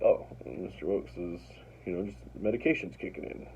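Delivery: soft, low voice of a pacified man, imitated; calmed down. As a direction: quietly voice his dawning understanding. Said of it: "he calms down".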